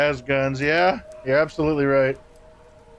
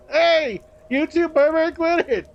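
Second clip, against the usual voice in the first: mock scolding; 11 semitones higher, slower than usual. Scolding chat users jokingly.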